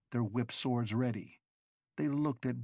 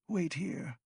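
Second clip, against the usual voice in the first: slightly breathless, a touch higher; a little breathless; 7 semitones higher than usual. A narrator's reading. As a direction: say it a bit out of breath. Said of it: "said Proclium a little breathlessly".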